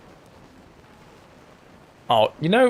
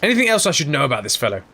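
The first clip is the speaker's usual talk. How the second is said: ordinary delivery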